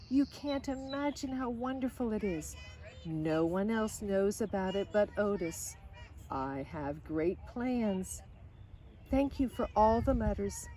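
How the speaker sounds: put-on lively storybook reading of a girl's letters; reading to children. A narrator's reading. Reading Lydia Grace's letters home to listening kids, storybook voice. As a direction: read it like a bedtime story, bright and gentle.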